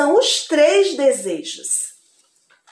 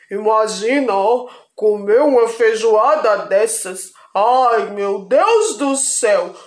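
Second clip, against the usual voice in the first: lazy husband voice; 2 semitones lower than usual. Acted. Husband dreaming of the feijoada.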